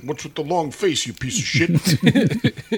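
high, slow mock yell through laughter; mock angry director. Director insulting the horse, slow laughing mock yell. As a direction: ham up a furious director at a horse.

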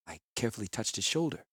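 plain reading voice

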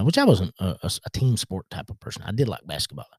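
usual speaking voice

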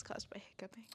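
soft unvoiced whisper. Real, not acted. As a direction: whisper the apology. Whispered apology.

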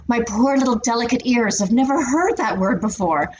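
mockingly precious and wounded; mock delicacy. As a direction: play the scandalized innocent, hammed up. Sarcastically mocking supposed delicate female ears.

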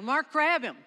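loud, high command; urgent yell. Retold or imitated. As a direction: shout the order before it's too late. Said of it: "And I yelled".

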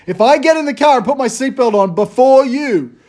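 dad losing patience at the car, winding up to a peak; mock-furious parent escalating; exasperated rant. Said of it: "That's what I say to Gideon".

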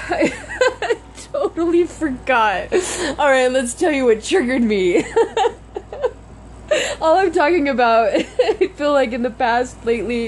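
laughing at herself while still upset; laughing through tears. Real, not acted.

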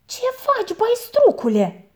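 high, sharp angry challenge; aunt catches him dragging an ox, high and angry; snapping aunt. A narrator's reading. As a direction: snap the question at him. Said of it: "se răsti la el femeia".

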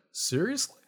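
high-pitched, cracked astonished question; retry the question, voice still cracking upward. Real, not acted. Doctor stunned by the new samples, cracking voice. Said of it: "why was my voice cracking?".

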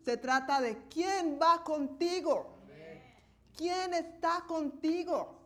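predicación enfática y más fuerte; predicando con fuerza. Acted. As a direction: proclama a la congregación. Preaching builds. (predicador con fervor)

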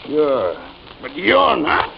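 ordinary delivery

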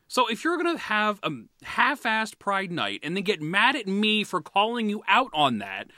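usual speaking voice

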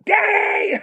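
very high, excited yell of "Daddy!"; imitated kid's shout. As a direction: shriek it like a thrilled little boy. Son shouts as ball comes.

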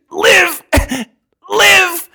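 high strangled plea between coughs; mock throttled squeal; imitated choking. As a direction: choke it out as if pinned by the throat. Choking man begging to live.

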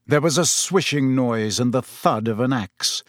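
ordinary delivery, nothing marked

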